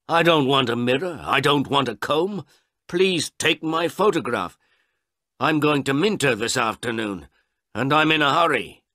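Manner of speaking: high, loud impatient protest; impatient old man. Acted. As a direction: push the photographer to hurry up.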